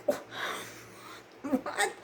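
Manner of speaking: high, soft, airy gasp of a question; retold breath-taken shock; stunned disbelief. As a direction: let it escape high and hushed, aghast. Stunned by nonpayment accusation.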